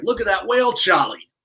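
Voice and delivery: high, loud mock Boston accent; thick Boston accent. Co-host mocks local speakers, exaggerated Boston accent. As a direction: exclaim like a caricature Bostonian. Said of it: "you'll see them like".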